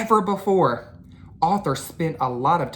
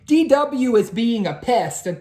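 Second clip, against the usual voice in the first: higher, louder tattling; whiny complaint; 7 semitones higher, 5 dB louder than usual. Acted. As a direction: tattle to Mom. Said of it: "called Arthur".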